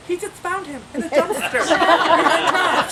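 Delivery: high, drawn-out comic wail; mock-scandalized gushing. Coffee girl telling a coworker, mock-scandalized. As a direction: spread the news in stretched-out amazement. Worker relaying news to coworker.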